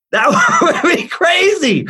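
high burst of laughter then laughing words. Real, not acted. Laughing at his own hypothetical, loud high laugh.

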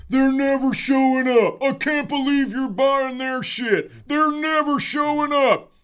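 put-on loud, high drunk ranting; drunk heckler. Drunk guy yelling at the merch tent crowd, loud high rant. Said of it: "this drunk guy's walking around".

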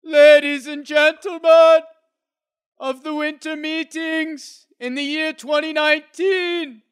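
high, slow, booming announcer proclamation; roman orator. Pretend. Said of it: "badass A plus Roman orator voice".

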